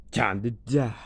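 villain chuckle before the threat; laugh, then deliver the threat; gloating villain laughing as he threatens. Acted.

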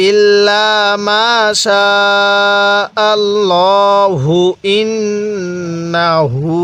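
আল্লাহু carried straight into ইন্নাহু, slow and drawn out; joined Quran recitation. Shown as a demonstration. Teacher showing students how to read it joined, slow chanted recitation. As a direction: link আল্লাহু to ইন্নাহু without stopping, chanted slowly. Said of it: "তো কিভাবে পড়বো?".

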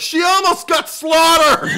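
high, loud, drawn-out outburst with a laugh; exasperated shout. Acted. Viewer reacting to Tatum's tactless remark, exasperated shout. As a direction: yell it slowly in comic outrage.

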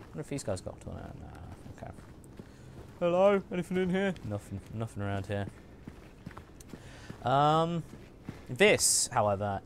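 usual speaking voice

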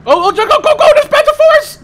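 all-out frantic battle yell; frantic shouting. Real, not acted. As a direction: yell it in a panic as the enemy appears. Panicked call during firefight.

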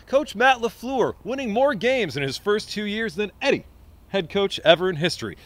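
ordinary talk, nothing marked

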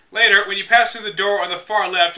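usual speaking voice

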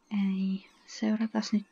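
whispered no. Real, not acted. Aside hushed to self.

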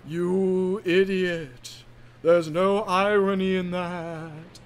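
slow, wailing ghost. Acted. Ghost of Diesel scolding the killer, slow ghostly drawl. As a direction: stretch the words out like a haunting spook. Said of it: "said the ghost of Diesel".

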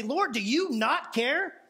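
stretched out, rising at the end; put-upon plea to the Lord; slow, rising question; slow audacious challenge. Retold or imitated. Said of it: "has the audacity to say".

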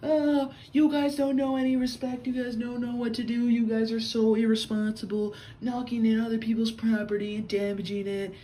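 high-pitched mocking rant; Karen going off. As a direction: imitate an indignant woman laying into everyone. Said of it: "She's going off".

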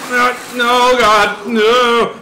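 loud, high pleading cries; drawn-out cries of alarm; panicked shout. Real, not acted. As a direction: yell in mounting dread. Attacked in game.

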